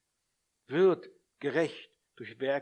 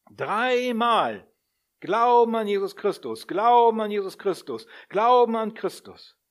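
loud, high, hammered repetition of the key words; emphatic repetition; 6 semitones higher, 10 dB louder than usual. Real, not acted. Preacher pounding the point to the congregation, loud and emphatic. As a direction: drive each Glauben home.

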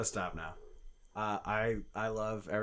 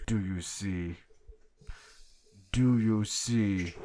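serial killer voice; much slower than usual. Acted. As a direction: whisper it like a killer savoring the moment. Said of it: "fantastic serial killer voice".

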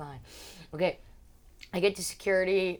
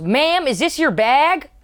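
loud, high, slow official call; mock security agent, loud and high; imitated security officer; 8 semitones higher, 13 dB louder, slower than usual. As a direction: call out slowly like airport security. Imitates airport security woman.

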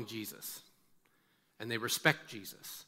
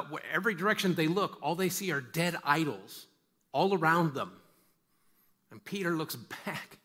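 ordinary delivery

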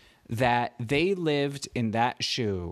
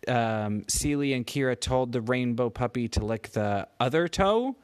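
usual speaking voice

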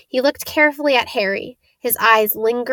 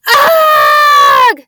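piercing high shriek of terror; terrible scream; 11 dB louder than usual. A narrator's reading. Malfoy screaming in terror at the hooded figure. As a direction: scream and bolt in panic. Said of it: "Malfoy let out a terrible scream".

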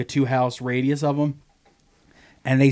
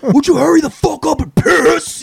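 much higher, louder mock snarl; hammed-up impatient cursing at a dog; harsh cursing; 13 semitones higher, 7 dB louder than usual. Owner cursing at his dog to hurry, mock harsh yell. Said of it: "harshly cursing at them".

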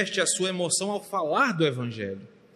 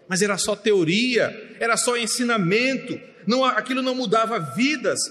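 high, forceful pulpit voice; pregação inflamada; 5 semitones higher than usual. Real, not acted. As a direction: preach it hard, each phrase a new push. Preaches at full force. (pregação exaltada, em voz alta e aguda)

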